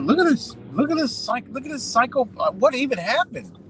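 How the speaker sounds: pitched high, in incredulous disbelief; pointing out a madman, amazed and rising; astonished retelling. Retold or imitated. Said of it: "I'm like".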